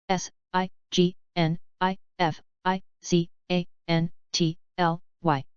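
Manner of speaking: slow, letter by letter; unhurried classroom spelling. Shown as a demonstration. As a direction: spell each letter out slowly. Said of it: "spelling drill, slow then fast".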